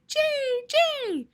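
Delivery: very high bird cry; high squawky jay call; blue jay call. Acted. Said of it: "They say their name".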